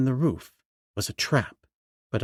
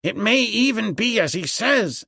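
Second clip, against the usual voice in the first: higher-pitched, alarmed servant; excited exclamation; 8 semitones higher than usual. A narrator's reading. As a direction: blurt it out as the danger dawns. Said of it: "exclaimed Pansat".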